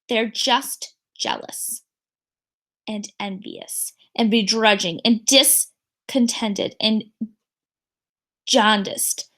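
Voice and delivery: slow, weighty list of words; slow deliberate father. Acted. Father reassuring daughter, slow and deliberate. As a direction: savour each word like a wise dad. Father lists insults slowly.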